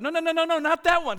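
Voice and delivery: high, loud mock panic; acted alarmed dad, urgent and high-pitched; frantic objection. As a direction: blurt it out to stop them at once. Dad objecting to channel choice.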